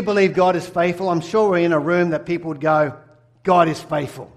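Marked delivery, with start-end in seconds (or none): none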